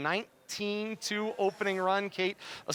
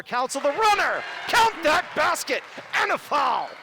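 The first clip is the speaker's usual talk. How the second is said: rising excited call of a basket and foul; excited play-by-play; 8 semitones higher, 6 dB louder than usual. Real, not acted. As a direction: call it loud and high.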